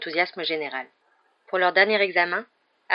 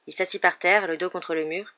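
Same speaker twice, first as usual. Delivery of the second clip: usual speaking voice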